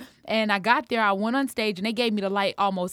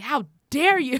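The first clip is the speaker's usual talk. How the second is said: high, louder mock outrage; retold indignation; 7 semitones higher, 8 dB louder than usual. Host replaying her hurt at losing the slot, mock indignant. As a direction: fling it out, dramatically affronted. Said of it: "I was so upset. I was so hurt".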